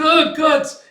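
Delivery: very high, loud call for a companion; panicked cry. Retold or imitated. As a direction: shriek his name for help. Puck cries out to Guts.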